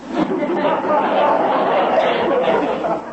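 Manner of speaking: a high, airy burst of laughter; a breathy high chuckle; real laugh. Real, not acted. Father laughs at Betty.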